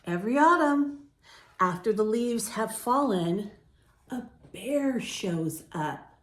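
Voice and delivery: slow, gentle read-aloud; story narration. A narrator's reading. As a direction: read it calmly to young listeners. Reads picture book to children.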